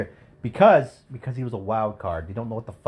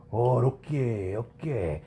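put-on slow lightly accented okay; a hint of the Japanese accent; much slower than usual. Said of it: "stereotypical Japanese accent".

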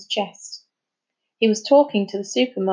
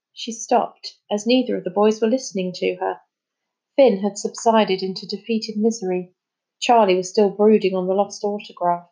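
ordinary delivery, nothing marked

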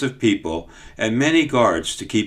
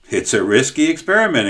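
higher, louder worried exclaim; 5 semitones higher, 4 dB louder than usual. Acted. Cook exclaims to the boy about the plan, worried. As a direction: blurt out the doubt. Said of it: "exclaimed the cook".

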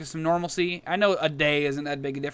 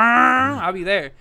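normal talking voice

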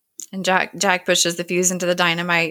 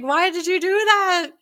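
much higher, hammed-up protest; mock yell; 12 semitones higher than usual. Hurley protests as Locke lights the fuse, high mock yell. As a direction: imitate a frantic yell, pitched way up.

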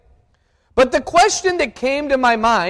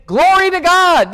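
crowd cheer; 8 semitones higher, 4 dB louder than usual. Retold or imitated. Crowd praising Jesus voiced.

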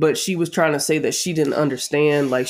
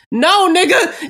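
high, drawn-out exasperated shout; exasperated yell; 14 semitones higher, 5 dB louder, slower than usual. Real, not acted. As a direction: yell it in disbelief. Exasperated outburst.

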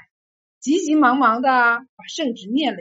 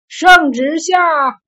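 drawn out, loud herald's call; shouted proclamation; 4 semitones higher, 11 dB louder, much slower than usual. A narrator's reading. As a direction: stretch out the decree call. Monk proclaims an imperial decree.

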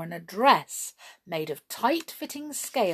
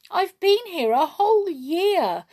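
high, loud wail of complaint; woeful little mermaid, pitched right up; distressed; 11 semitones higher, 9 dB louder than usual. A narrator's reading. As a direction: lament it plaintively. Mermaid laments her captivity.

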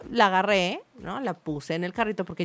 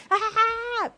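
imitated tantrum scream; 12 semitones higher, 4 dB quieter than usual. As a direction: mimic the child's shriek, mildly. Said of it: "eran unos gritos así". (grito de berrinche imitado)

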